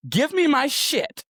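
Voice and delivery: high, loud, slow demand; imagined fury. Retold or imitated. Wanting to yell at her ex, furious demand. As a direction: spit it out like you've finally snapped. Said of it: "all I wanted to do is be like".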